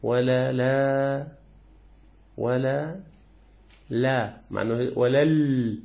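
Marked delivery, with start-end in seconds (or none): 0.0-4.4 ولا لا said very slowly, the ل carrying the hamza's vowel; Warsh reading, very slow. Shown as a demonstration. Teacher slowly spelling out the Warsh reading. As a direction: stretch out ولا لا syllable by syllable. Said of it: "هكذا يقرأ ورش".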